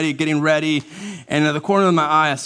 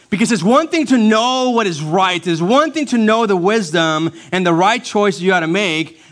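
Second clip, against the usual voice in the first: raised, louder pulpit delivery; impassioned preaching; 7 semitones higher, 5 dB louder than usual. Acted. Pastor urging congregation toward right choices, impassioned preaching. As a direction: drive the point home from the pulpit.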